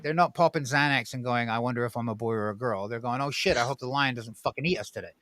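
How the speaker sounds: usual speaking voice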